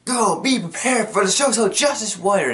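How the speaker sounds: mock battle cry, pitched high; Gumball. He challenges her with a lightsaber, mock heroic. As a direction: declare it in a put-on high voice.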